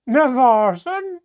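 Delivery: loud, high, drawn-out mocking cry; shrieking dwarf mockery; cackling dwarf. A narrator's reading. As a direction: cackle the name in disbelief, high and slow. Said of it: "cackled the dwarf".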